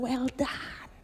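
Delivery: breathy whispered well done from God; whispered praise. Acted. God longing to tell the servant, whispered tenderly. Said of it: "Saya menunggu untuk bilang".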